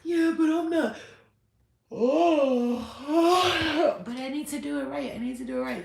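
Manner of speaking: over-the-top high perfectionist protest with a sigh; hammed-up little miss. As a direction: whine like a Virgo who must get it right. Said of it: "little miss".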